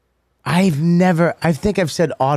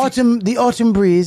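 lilting, higher mock British accent; 7 semitones higher than usual. Comic imitating an English person on autumn, higher mock British accent. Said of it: "in a British accent".

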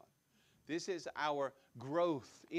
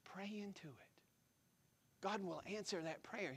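whisper; 3 semitones higher, 8 dB quieter than usual. Real, not acted. Intimate exhortation to the congregation.

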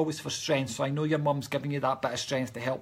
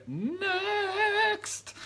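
long, rising, held note; drawn-out next. Real, not acted. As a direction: swoop high and hold it. Stalls while choosing next person.

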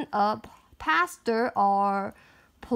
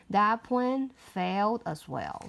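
usual speaking voice

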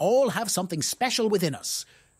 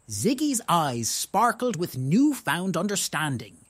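ordinary delivery, nothing marked

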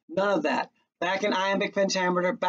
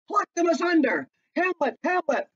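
alarmed cry; 10 semitones higher than usual. Acted. Queen crying out in the fight.

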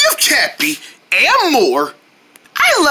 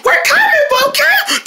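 much higher shouted call; squeaky character call. Pretend. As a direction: call out like a cartoon helper. Character calls out.

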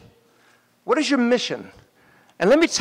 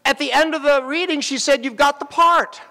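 excited retelling; 7 semitones higher than usual. Retold or imitated.

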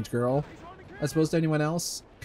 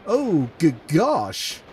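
slow, high, louder cartoon dog voice; Goofy voice; 4 semitones higher, 6 dB louder, much slower than usual. Reading a sex scene aloud, slips into a Goofy voice. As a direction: drag it out like Goofy. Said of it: "I read that in Goofy's voice".

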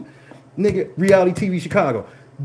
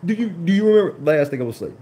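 normal talking voice

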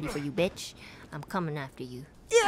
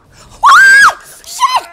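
extremely high, loud shriek and curse; shriek, then scream the curse; piercing jump-scare scream; terrified scream; 22 dB louder than usual. Real, not acted.

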